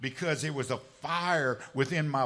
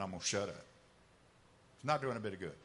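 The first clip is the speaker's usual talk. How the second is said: resigned, quiet; 6 semitones lower, 5 dB quieter, much faster than usual. Acted. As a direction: lower and softer, giving up. Same vow repeated, resigned.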